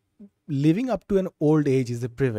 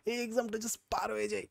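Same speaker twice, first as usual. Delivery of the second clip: high-pitched pleading inner voice; naive exam-time self; 7 semitones higher than usual. Acted. Speaker mimicking their younger self before school exams, high and pleading. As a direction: act out a fretting student's desperate wish. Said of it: "আমার just মনে হয়".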